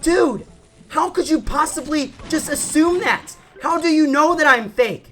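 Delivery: high-pitched, hammed-up disbelief; indignant protest. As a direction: argue back in an exasperated high voice. Retold protest against the accusation.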